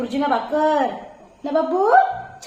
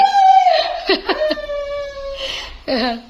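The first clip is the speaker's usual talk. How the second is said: burst of laughter; 11 semitones higher than usual. Real, not acted. Big laugh at the dog.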